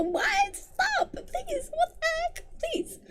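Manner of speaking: very high, thin whine; exasperated pleading. Real, not acted. Reader begging the edgy cliché to stop, high exasperated whine. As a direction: squeal it softly, at wits' end.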